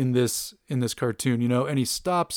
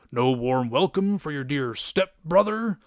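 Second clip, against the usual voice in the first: put-on cartoon villain voice; mock greeting in a lightly piratical villain voice; pirate-like Juggernaut. Juggernaut breaking into the mansion, pirate-like villain voice. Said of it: "basically just like a pirate".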